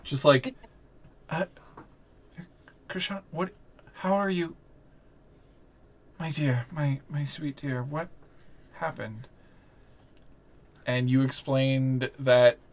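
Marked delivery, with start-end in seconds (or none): 1.3-9.3 soft, halting disbelief; stunned, tender woman. Acted. Game master as Willow finding Krishan, softly stunned and halting. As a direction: falter softly as you greet a lost beloved. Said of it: "and she goes".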